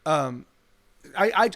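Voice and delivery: normal talking voice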